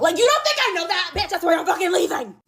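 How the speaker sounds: high, pushed angry retort; retold fed-up outburst; exasperated snapping. Snapping back at instigators.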